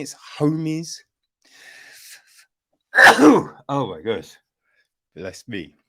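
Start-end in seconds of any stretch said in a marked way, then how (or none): 2.9-3.6 sudden loud hacking cough; a real cough that bursts out into the mic. Real, not acted. Vlogger coughs loudly mid-review, loud hacking cough. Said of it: "Hope that didn't hurt your ears".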